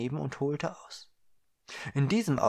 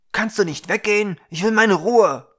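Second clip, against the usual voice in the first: much louder, higher, fed up; irritated shout; 8 semitones higher, 11 dB louder than usual. Acted. Young man snapping at the girl watching him, loud and irritated. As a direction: leave me alone, now. Blaugrün annoyed at staring girl.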